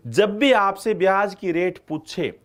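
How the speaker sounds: slow, deliberate drilling of the rule; slow emphatic teaching. Shown as a demonstration. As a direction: spell out the rule slowly so it sticks. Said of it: "आपके दिमाग में बिठा लो".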